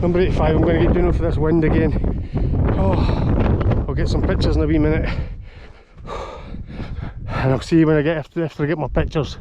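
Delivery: talking over gusting wind; pushing the voice out against the wind; in strong wind. Real, not acted. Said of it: "As you can hear, it's windy up here".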